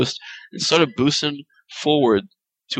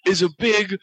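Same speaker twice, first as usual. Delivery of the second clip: higher-pitched mock Aussie wildlife guide; Australian accent; 5 semitones higher than usual. Host narrates a sniper hunt on request, Australian accent. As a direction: put on a broad Australian, pitched up throughout. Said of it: "do an Australian accent".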